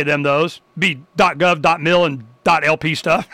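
ordinary talk, nothing marked

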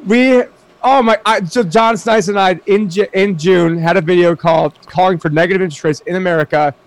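high-pitched, tumbling astonishment, easing down; amazed excitement. Real, not acted. Cohost recalls video calling for negative rates, excited. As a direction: stumble over words in excitement. Reacting to predicted rate news.

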